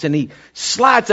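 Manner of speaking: ordinary talk, nothing marked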